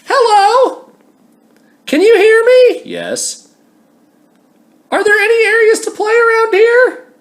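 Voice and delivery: very high, bright-voiced cheery stranger; sing-song high stranger calling out; chirpy human. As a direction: squeak it like an eager chatterbox. Voices chirpy human trying to chat.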